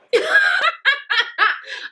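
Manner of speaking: high-pitched burst of laughter; amused laughing fit. Real, not acted. Laughs at the retold question.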